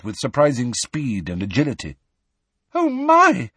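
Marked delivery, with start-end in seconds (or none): 2.7-3.5 very high, loud startled squeal; terrified squeal. A narrator's reading. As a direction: squeal it in sudden terror. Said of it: "Harry heard Hermione squeal, terrified".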